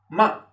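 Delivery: short falling Mandarin tone on "ma"; fourth tone. Shown as a demonstration. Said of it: "tenemos el cuarto tono".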